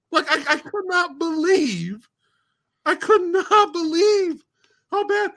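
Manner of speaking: slow, high-pitched disbelief; incredulous. Real, not acted. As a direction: stretch each word out high, appalled. Incredulous at how bad it was.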